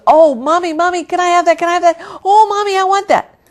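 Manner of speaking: put-on high, loud, pleading child voice; a five-year-old whining for a necklace; begging little girl. Little Jenny begging her mommy for the pearl necklace, high pleading child voice.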